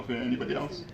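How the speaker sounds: usual speaking voice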